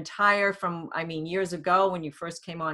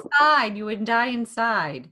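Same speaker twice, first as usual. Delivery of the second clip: emphatic; 5 semitones higher, 4 dB louder than usual. Real, not acted. Stressing how hiding would feel.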